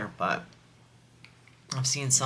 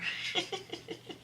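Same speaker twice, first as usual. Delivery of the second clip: high breathy giggle; 9 dB quieter than usual. Real, not acted. Laughing at inside joke.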